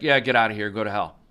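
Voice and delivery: mock God. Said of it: "And God's like".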